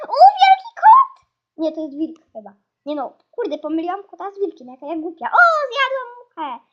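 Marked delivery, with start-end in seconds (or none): 0.1-1.3 very loud, very high exclamation; startled shriek. Real, not acted. Gamer spots a big cat, startled shriek. 5.3-6.6 loud, high burst of glee; triumphant exclamation. Real, not acted. Player finally ate the fly, triumphant exclamation.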